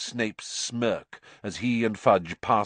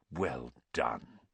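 much lower, soft warm praise; 10 semitones lower, 10 dB quieter than usual. A narrator's reading. Dumbledore beaming at the children, soft warm praise. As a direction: praise them gently.

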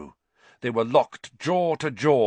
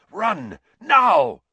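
high, loud urgent order; urgent command; 12 semitones higher, 9 dB louder than usual. A narrator's reading. Sirius orders the children to flee, shouting urgently. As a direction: build to a sudden shout. Sirius urges escape from werewolf.

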